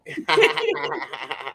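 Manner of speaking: hearty laughing burst; open, amused laughter; real laugh. Real, not acted. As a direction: laugh out at your own joke. Laughing at own joke.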